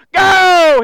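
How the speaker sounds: a loud, high "Go"; shouted command. Acted. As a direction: bark the word "Go" out like an order. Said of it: "Exclamation point".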